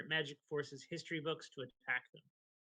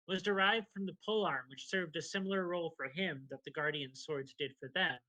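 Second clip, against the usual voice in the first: ordinary talk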